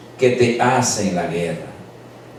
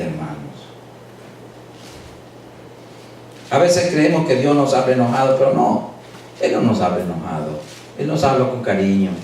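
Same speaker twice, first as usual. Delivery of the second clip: low, soft, loving sermon voice; tender preaching; 5 semitones lower, 4 dB quieter than usual. Acted. Pastor softly assuring the congregation of God's love. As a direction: speak of God's love gently.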